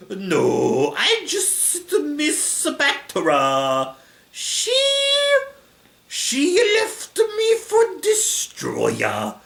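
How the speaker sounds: put-on loud Italian accent, swooping very high; Ghidorah. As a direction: protest in a slow, wailing Italian accent. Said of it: "doing an Italian accent for Ghidorah".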